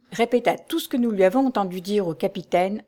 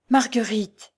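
cry of recognition; slower than usual. A narrator's reading. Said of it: "s'écria".

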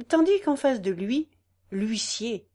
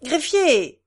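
louder, higher call to a sleepy clerk; 6 semitones higher, 7 dB louder than usual. A narrator's reading. Judge calling the dozing clerk, louder. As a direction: snap the clerk awake. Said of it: "répéta le juge".

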